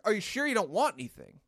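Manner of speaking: retold insistent question with swooping pitch; pushy promoter. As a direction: imitate a woman pressing the offer. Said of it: "She's like".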